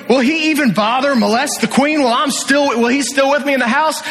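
the king appalled at what he sees; king finding Haman at the queen's feet, outraged; exclaiming king. Retold or imitated. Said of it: "the king exclaimed".

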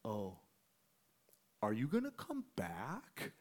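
very soft, slow question with a rise; tentative big dude. Retold or imitated. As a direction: imitate a big guy asking hopefully in a small voice. Big dude retold, timidly asking.

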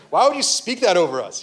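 mock indignant. Imagined objection from the staff.

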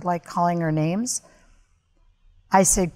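ordinary talk

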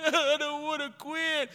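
high, blubbering mock protest; crying recruit. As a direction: whine it through put-on tears. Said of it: "'cause I'm crying".

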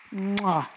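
big smacking kiss noise; affectionate "mwah" send-off; blown kiss. Real, not acted. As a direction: blow an audible farewell kiss. Blows a kiss to Shirley.

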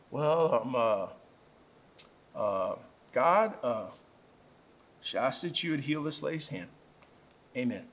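small, low, halting prayer full of ums; hesitant child's prayer. Retold or imitated. Macy asked to pray for the lady, quiet and unsure. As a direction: pray timidly, feeling for each word. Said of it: "looks at me with, like, big wide eyes".

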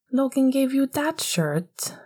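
high, slow, appalled question. Acted. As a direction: sound shocked by it. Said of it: "sounded like he was appalled".

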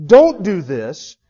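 "Don't" leaned on, slow and loud; stressed don't. Shown as a demonstration. Preacher stressing "Don't" to the congregation. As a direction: hammer the "Don't" slowly. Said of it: "the emphasis is on".

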